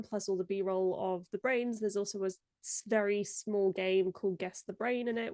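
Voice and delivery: ordinary talk, nothing marked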